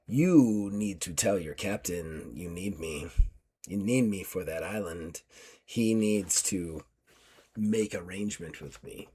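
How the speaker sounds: quiet, slow and menacing; low menacing. Acted. Warrior woman at the cage demanding a deal, quiet menace. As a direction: threaten him softly through the bars. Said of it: "looks at you and goes".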